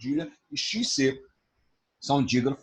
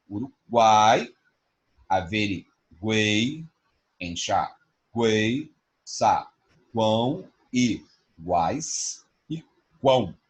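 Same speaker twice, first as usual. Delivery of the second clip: slow syllable demo; 3 semitones lower, much slower than usual. Shown as a demonstration. Teacher drawing out triphthong examples for students. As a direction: slowly stretch out each triphthong. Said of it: "triphthong examples slowly spoken".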